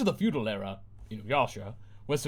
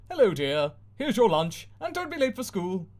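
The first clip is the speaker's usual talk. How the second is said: high, sing-song mum reminder; cheery TV mum; 7 semitones higher, 3 dB louder than usual. Acted. Mum on screen sending daughter off, chirpy and high.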